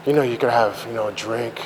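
the craving whispering in his head; imitating the quiet voice of addiction luring him; tempting inner voice. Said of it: "that fucking voice".

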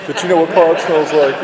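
indignant. Retold or imitated. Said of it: "I'd be like".

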